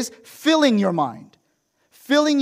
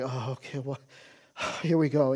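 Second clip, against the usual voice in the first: softer, resigned mutter with a sigh; 5 semitones lower, 6 dB quieter than usual. Retold or imitated. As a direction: sigh it out like a weary student.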